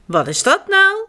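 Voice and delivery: a mother puzzled by the noise; mother asking. A narrator's reading. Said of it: "vraagt Janneke's moeder". (hogere verbaasde moederstem)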